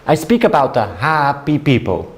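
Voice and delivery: put-on light Italian accent, eager; lightly accented, earnest broken English; Italian businessman. Salesman explaining happy workers to his seatmate, light Italian accent. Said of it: "he says".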